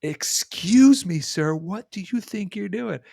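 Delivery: high, drawn-out indignant "excuse me"; full sass. Retold or imitated. Woman confronting a line-cutter in Costco, sassy. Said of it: "sass of a thousand suns".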